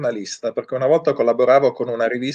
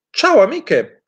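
cheerful greeting; 9 semitones higher, 4 dB louder, much slower than usual. A narrator's reading. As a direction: greet your friends sweetly. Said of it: "salutò la mosca bianca".

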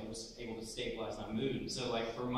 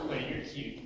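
laughing through it; slower than usual. Retold or imitated. Retold flirting, laughing.

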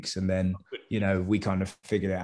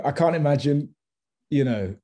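usual speaking voice

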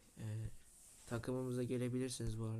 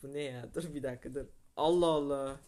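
exasperated; 8 dB louder than usual. Real, not acted. Baffled by strange game play.

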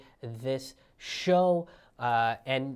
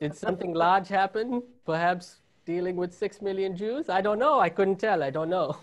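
higher, louder mock German accent; an overplayed innocent German; 5 semitones higher, 5 dB louder than usual. Germans feigning ignorance of the war, mock German accent. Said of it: "the best German accent you guys are gonna get out of me".

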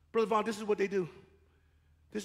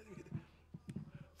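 whispered aside; 18 dB quieter, slower than usual. Real, not acted. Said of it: "whispered repeat of aside".

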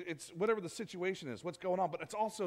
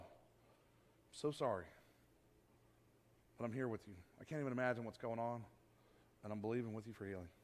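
soft tender comfort; 8 semitones lower, 9 dB quieter than usual. Shown as a demonstration. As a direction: ease a sick friend with a hushed voice. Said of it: "It's a big difference".

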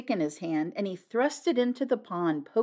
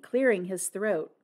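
ordinary delivery, nothing marked

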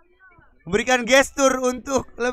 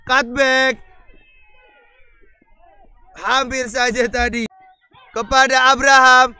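ordinary delivery

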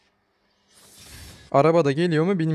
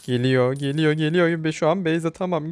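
ordinary talk, nothing marked

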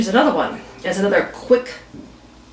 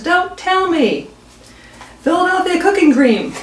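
higher, slowed catcher voice, mock weary; knowing catcher; 8 semitones higher than usual. Catcher finds the cream again, mock knowing tone. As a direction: drag it out as if he saw it coming. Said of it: "He says".

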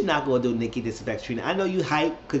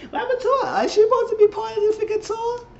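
high squealing falsetto, louder; mock outraged question; 6 dB louder than usual. Asking where J Diva went, in a loud squealing falsetto. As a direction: shriek the question in a put-on girly voice.

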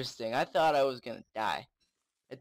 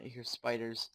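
ordinary talk

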